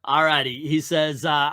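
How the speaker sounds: ordinary delivery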